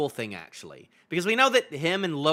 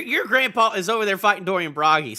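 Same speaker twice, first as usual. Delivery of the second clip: ordinary talk, nothing marked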